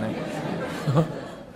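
soft airy laugh after the joke; breathy laugh. Real, not acted. To the audience, laughing at his own story, airy chuckle. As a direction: let out a quiet breathy chuckle.

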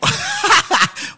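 a big, high, delighted laugh. Real, not acted. Host bursts out laughing at caller.

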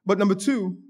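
usual speaking voice

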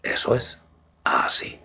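intimate whisper; right in your ear. Acted. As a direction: whisper close to the mic. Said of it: "escucha solo mi voz".

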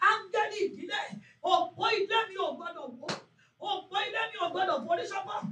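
loud chanting prayer, near shouting; fervent prayer. Acted. Pastor leading YouTube mothers in prayer, loud fervent chanting. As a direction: cry out the prayer and urge them on.

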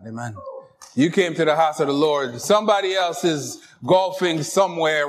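ordinary talk

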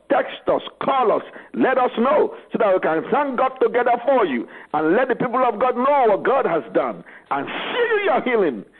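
ordinary delivery

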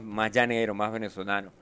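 ordinary talk

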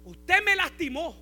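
hurt outcry. Retold or imitated. Wounded churchgoer crying out at the pastor, loud and hurt. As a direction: wail it like someone hurt by a light touch. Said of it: "Y cuando usted grita".

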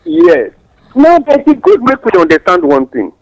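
ordinary delivery

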